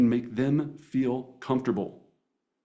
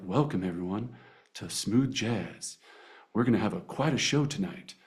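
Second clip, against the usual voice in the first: smooth jazz DJ; 4 semitones lower, 4 dB quieter than usual. Shown as a demonstration. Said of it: "we gotta go into smooth jazz".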